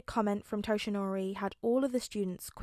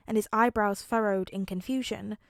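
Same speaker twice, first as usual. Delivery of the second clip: plain reading voice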